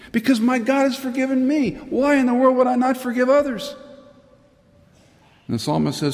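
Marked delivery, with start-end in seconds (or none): 0.1-3.7 impassioned. Real, not acted. Impassioned climax of sermon.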